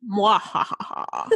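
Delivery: slow, hammy evil mwahaha; sneering panto villain. Pretend. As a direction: cackle like a pantomime baddie. Mock evil laugh at goodbye.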